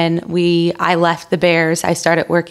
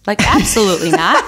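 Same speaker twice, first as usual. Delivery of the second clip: laughing refusal. Retold or imitated.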